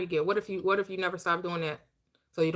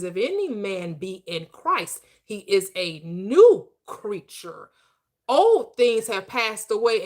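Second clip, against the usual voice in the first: slow verse that swells louder at the end; scripture preaching; 2 semitones higher, 6 dB louder, much slower than usual. Acted. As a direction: preach the verse, building in force. Recites scripture, rising.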